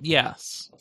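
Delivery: normal talking voice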